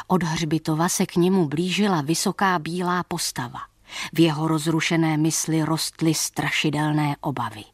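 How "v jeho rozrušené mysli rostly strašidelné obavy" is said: ordinary delivery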